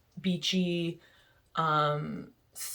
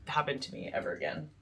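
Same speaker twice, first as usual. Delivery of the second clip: usual speaking voice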